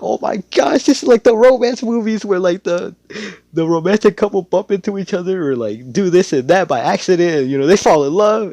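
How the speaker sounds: very high, loud squealing mom voice; hammed-up delighted gushing. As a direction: swoon like an overexcited mother. Mom gushes over romance.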